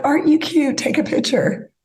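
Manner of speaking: high, sing-song imitation of the photo staff; mock cutesy photographer. Woman imitating photo staff cooing at the new couple, high mock cutesy. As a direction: gush at the couple like a cheesy photographer.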